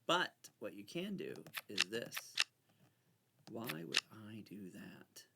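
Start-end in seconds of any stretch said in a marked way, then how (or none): 3.5-5.2 whispered to herself. Real, not acted. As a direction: breathe the question under your breath. Muttering to herself.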